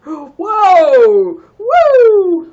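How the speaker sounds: sudden gasp, then a high, loud cheer; excited whoop. Real, not acted. Player yelps at something happening in her base, excited whoop. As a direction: let it burst out, real delight.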